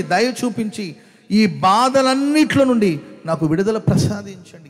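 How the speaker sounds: loud, high, slow pleading prayer cry; a desperate wail of prayer to be delivered. Acted. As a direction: beg God aloud, stretching every word. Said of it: "ప్రార్థన చేస్తాడు".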